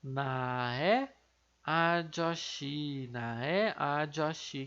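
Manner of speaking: Nae Ajoshi said slowly in Korean; Korean title. Shown as a demonstration. Teacher sounding out "Nae Ajoshi" for the class. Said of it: "aqui tá o título dele, que é".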